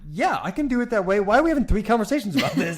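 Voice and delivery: mock frustration at repeating; hammed-up exasperated husband; exasperated reply.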